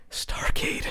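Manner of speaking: breathless, soft whispered word. Retold or imitated. Telling the co-host his reaction as a viewer, whispered. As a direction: barely voice it, awestruck.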